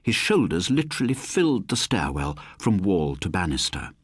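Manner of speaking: ordinary delivery, nothing marked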